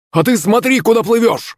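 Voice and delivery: very high-pitched indignant bellow; high furious character yell; roaring. A narrator's reading. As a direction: roar back in a high, strained voice. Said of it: "взревел Захарыч".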